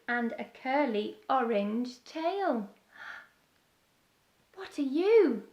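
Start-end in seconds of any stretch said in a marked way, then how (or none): 4.6-5.5 loud call, voice lifted toward the sky. Acted. Beaky calls to a bird in the air, loud call. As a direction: call up to the bird circling above. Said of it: "called Beaky".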